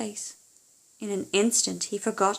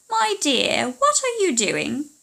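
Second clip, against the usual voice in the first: high, sweet-sounding witch calling out; sugary high witch call, slow on the question; 7 semitones higher, 6 dB louder, slower than usual. Acted. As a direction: call out like a crafty old witch. Witch calls out again.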